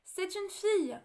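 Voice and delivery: la sage-femme s'écrie, plus haute et plus forte; cri joyeux. A narrator's reading. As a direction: annonce la naissance avec éclat. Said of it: "s'écria la sage-femme". (la sage-femme annonce au roi la fille, cri joyeux)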